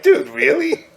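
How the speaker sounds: a high, stunned challenge to the guy; incredulous. Retold or imitated. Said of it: "I'm like".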